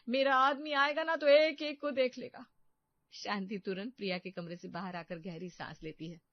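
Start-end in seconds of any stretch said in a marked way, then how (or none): 0.1-2.4 louder, high threatening declaration; loud threat. Acted. Daughter-in-law threatens family with her husband, loud threat. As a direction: threaten them all. Bahu threatens whole household.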